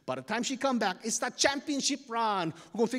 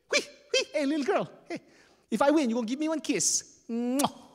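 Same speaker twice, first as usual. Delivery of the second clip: flirty call; 7 semitones higher than usual. Acted. Ahua flirting with wahine.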